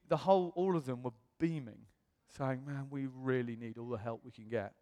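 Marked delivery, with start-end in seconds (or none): 2.6-4.7 earnest plea. Retold or imitated. Family's earnest welcome, retold.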